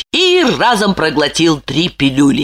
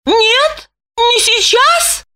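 high rising question on сейчас; astonished; much slower than usual. A narrator's reading. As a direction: echo it in disbelief. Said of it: "изумилась Липочка".

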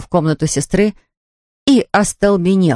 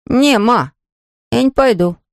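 put-on higher-pitched boy refusing his mother; reluctant son; 6 semitones higher than usual. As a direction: voice a son who won't go. Сын спорит с мамой. (упрямый сын, голос повыше)

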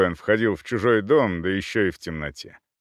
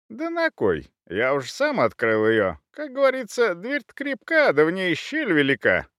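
light, higher-pitched thief voice; thief's cocky reply; 8 semitones higher than usual. Acted. Hedgehog thief answers his comrade about the door, cocky. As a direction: pitch it up, airy and pleased with the trick.

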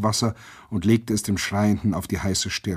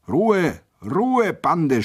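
shrill order; 7 semitones higher, 3 dB louder, much slower than usual. A narrator's reading. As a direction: shriek it up from the yard. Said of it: "schrillte es schon wieder".

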